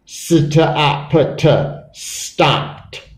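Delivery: S, t, o, p, t, then stopped; sounded out. Shown as a demonstration. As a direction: separate each sound, finish stopped with a t. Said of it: "so E D sounds like t".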